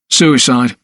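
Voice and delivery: unembellished, matter-of-fact answer; neutral. A narrator's reading. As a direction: state it without embellishment. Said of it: "said neutrally".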